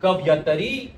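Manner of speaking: कवयतरी said ka-vay-ta-ri with the spoken vowel; correct pronunciation. Shown as a demonstration. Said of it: "तो इसका उच्चारण है". (कवयतरी को बोलचाल के उच्चारण में)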